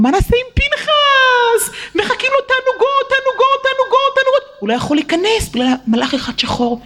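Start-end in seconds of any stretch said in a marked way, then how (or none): 0.0-4.4 God lamenting. Acted. God grieving over Pinchas, high and tearful. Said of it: "אמר הקדוש ברוך הוא".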